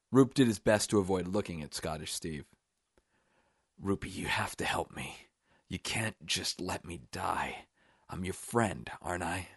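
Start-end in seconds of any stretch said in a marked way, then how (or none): 3.8-9.5 whispered pleading. A narrator's reading. Dying man begs his friend Roop to help, whispering. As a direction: beg breathily with failing strength.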